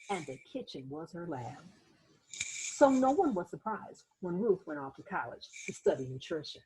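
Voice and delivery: put-on gentle read-aloud narration for children; teacher reading a picture book aloud; story narration. A narrator's reading. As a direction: tell it like a bedtime storybook.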